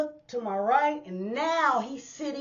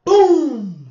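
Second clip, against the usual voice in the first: louder punchy boom; sound effect boom; 3 semitones higher, 11 dB louder than usual. Retold or imitated. Telling the audience how the punch landed. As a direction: hit it like a punch landing. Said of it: "It was like".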